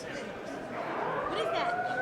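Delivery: high, slow, startled question; startled by a sudden noise; surprised. Real, not acted.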